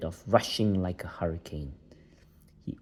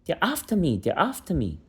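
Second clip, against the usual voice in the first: frightened cry; 9 semitones higher than usual. A narrator's reading. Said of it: "cried Lenny".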